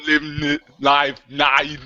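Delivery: mock Schwarzenegger promo voice ending in a garbled yell; Arnold Schwarzenegger. Host asked to do next week's promo, hammed-up Schwarzenegger. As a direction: do a hammed-up Arnold plug, dissolving into noise. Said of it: "finally got Arnold Schwarzenegger".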